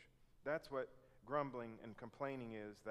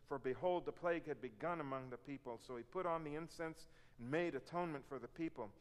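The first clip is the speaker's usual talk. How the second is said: ordinary talk, nothing marked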